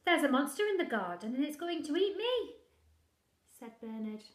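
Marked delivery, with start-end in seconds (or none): 0.0-2.5 put-on loud, high alarmed boy; urgent child. Bernard urgently warning his mother of the monster. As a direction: blurt it out in a panic.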